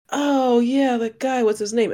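high, put-on puzzlement; feigned ignorance. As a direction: play dumb along with the group. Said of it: "I was trying to play it cool".

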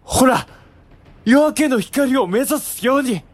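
loud, eager calling out; excited shout. Acted. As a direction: call out, pointing to the sky. Brother calls out to general.

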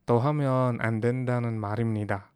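quick demo read of the Korean line; fast example sentence. Shown as a demonstration. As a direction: say the example briskly. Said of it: "Another example".

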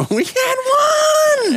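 put-on very high, slow, shouted old lady. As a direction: wail it out like a frail old lady. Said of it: "That's the voice I'm doing".

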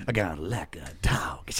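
soft unvoiced whisper. Real, not acted. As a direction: breathe it like a secret. Hushed aside about his dog.

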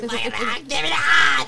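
high, loud yell for the eye; hammed-up cartoon witch scream; mock witch screech. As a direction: shriek like a cartoon witch fighting over the eye. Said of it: "screaming at each other while they're arguing".

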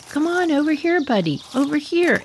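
high-pitched call to an animal; coaxing call. Retold or imitated. Narrator calling the lost horse over, high and coaxing.

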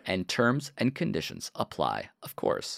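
hushed fine-print whisper. Pretend. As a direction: murmur the disclaimer under your breath. Whispered disclaimer in ad.

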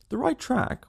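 high, incredulous echo of the question; a mock-astonished detective; scornful echo. A narrator's reading. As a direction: toss the words back in surprise.

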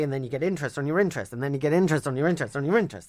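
rattled off fast, piling up. Shown as a demonstration. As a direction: speed up as it snowballs. Rapid repeating for effect.

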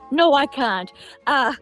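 normal talking voice

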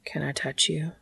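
soft, intimate murmur. Acted. Dean asks Cass's permission after a kiss, murmuring softly. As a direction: ask it very quietly. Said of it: "he murmured".

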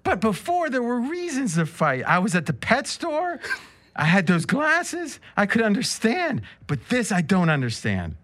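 high, eager Adrian; bright and high as Adrian; excited Adrian. Acted. Adrian reading Rocky's line, high and eager. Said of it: "He's so excited".

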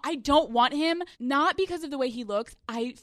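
ordinary talk

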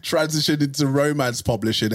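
ordinary talk, nothing marked

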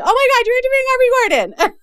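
mimicking an excited fan who can't believe the news; excited fan quote. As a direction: gush like a thrilled listener. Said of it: "you've written to me saying".

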